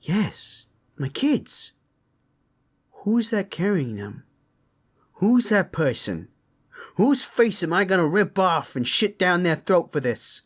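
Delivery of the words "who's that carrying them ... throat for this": raised, furious demand for a culprit; father boiling over into violent threats; barbarian rage. Acted. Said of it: "He's got the barbarian rage in him".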